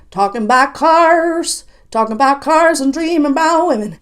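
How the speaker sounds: high, loud country singer twang; sing-speak it like a country singer. Retold or imitated. Mocking what country singers sing about, loud country twang. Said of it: "country singers do that".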